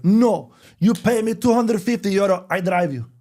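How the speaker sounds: put-on high, loud Greek-accented broken English; pushy driver naming his price; Greek taxi driver. Taxi driver haggling with tourists, high loud Greek accent. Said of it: "the guy was like".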